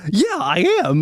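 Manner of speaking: proud yes. Retold or imitated. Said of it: "I was like".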